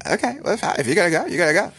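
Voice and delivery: high, put-on woman's voice; hammed-up feminine 'fine'; mock-agreeable girlfriend. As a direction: imitate a girlfriend pretending it's fine. Retold girlfriend feigns letting him go.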